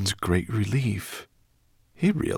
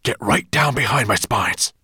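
loud, forceful dragon voice; urgent dragon command; 4 semitones higher, 6 dB louder than usual. A narrator's reading. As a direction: order them down, urgently. Dragon urges the children.

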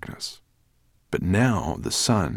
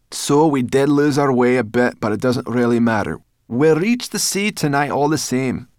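higher, loud, dismissive voice; brash brownie girl; 6 semitones higher, 7 dB louder than usual. A narrator's reading. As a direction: brush it off loudly. Brownie girl brushes off mistake.

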